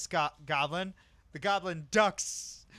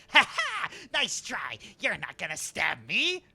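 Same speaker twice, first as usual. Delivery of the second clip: taunting goblin; 4 dB louder, slower than usual. Acted. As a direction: jeer right before getting stabbed.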